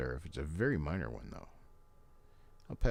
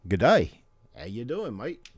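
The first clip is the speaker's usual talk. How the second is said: put-on light Australian accent, higher and loud; a hint of an Aussie bushman's greeting; Crocodile Dundee impression; 8 semitones higher, 11 dB louder than usual. Vlogger doing his best Crocodile Dundee, a light Australian accent. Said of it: "That's my best, uh, Crocodile Dundee".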